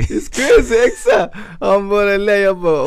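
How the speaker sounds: retelling his job interview panic, laughing. Real, not acted. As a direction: laugh your way through it.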